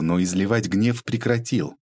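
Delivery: usual speaking voice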